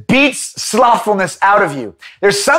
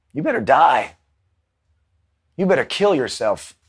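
much lower, much softer grave warning; hushed intense preaching; 8 semitones lower, 7 dB quieter than usual. Acted. Preacher warning the congregation to crucify the flesh, hushed. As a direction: lean in and warn them gravely.

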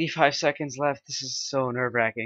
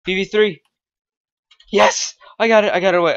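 excited relief; 7 semitones higher, 10 dB louder than usual. Real, not acted. Escaped successfully.

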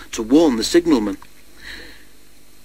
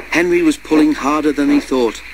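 ordinary delivery

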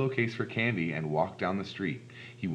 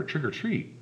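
higher-pitched, more insistent repeat; a little louder; 7 semitones higher than usual. A narrator's reading. Lance trying the fallen lady a second time, slightly more insistent. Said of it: "He said it a little louder".

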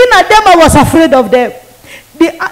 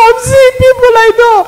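high, fast words through crying; 7 semitones higher, faster than usual. Retold or imitated. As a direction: sob it out in a rush. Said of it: "I was crying".

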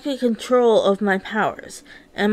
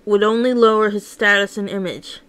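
ordinary delivery

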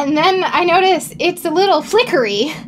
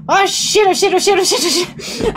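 retold panic; 4 semitones higher than usual. Reliving the grease fire for the stream, mildly panicked. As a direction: repeat it, rattled, as the pan flares. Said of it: "and I'm like".

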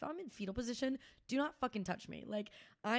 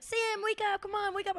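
high, pestering imitation of a friend; mock wake-up pleading, pitched high; urging someone awake; 12 semitones higher, 4 dB louder than usual. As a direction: nag a sleeper in a raised pitch. Voicing friend trying to rouse her.

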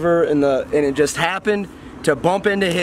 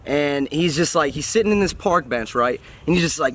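normal talking voice

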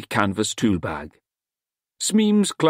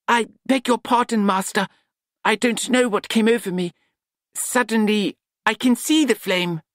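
put-on higher, sly apologetic young voice; mock-apologetic apprentice; 10 semitones higher than usual. A narrator's reading. Nut pretending to see the flame, higher mock apology. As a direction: back down with a wink in it.

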